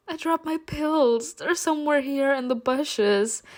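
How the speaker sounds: high, tearful put-on whine; fake crying. Narrator lies to the groom about pills, high tearful whine. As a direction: whine it as if still sobbing. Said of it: "started crying loudly".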